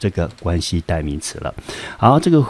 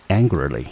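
"angrily" with the stress on its first syllable, AN-gri-ly. Shown as a demonstration. Teacher modelling a new word for students, first-syllable stress. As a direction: model "angrily" clearly, leaning on AN. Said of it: "整个字重音节落在第一个音节".

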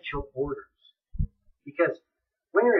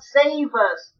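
raised, pleading cry to be saved; rising crowd plea, each save us louder; 7 semitones higher, 6 dB louder, much slower than usual. Acted. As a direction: call it out like a begging crowd. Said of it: "the people are crying out".

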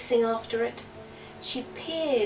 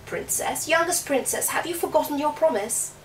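higher, louder croaky call; frog voice; 6 semitones higher, 3 dB louder than usual. Acted. Frog calls out again.

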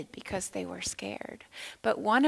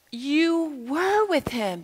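higher, slow, insistent accusation; pointed accusation; 6 semitones higher, 6 dB louder, slower than usual. Acted. A third person in the courtyard accuses Peter, slow and insistent. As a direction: lean in and accuse him slowly.

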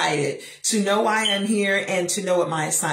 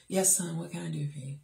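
soft low syrupy reply; sweet mama voice; 5 semitones lower, 9 dB quieter than usual. Retold or imitated. Mother answering her son's call, sugary and soft. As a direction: lay the sweetness on thick. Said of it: "I'm giving him this voice".

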